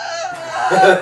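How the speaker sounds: high, loud scream through laughter; half-laughing cry of pain. Retold or imitated. Laughing as he retells the pain, mock scream.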